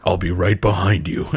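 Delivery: low, heavy gorilla-hero voice; Winston voice. Retold or imitated. Winston promising his friends he'll follow, deep and steady. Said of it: "And then he's like".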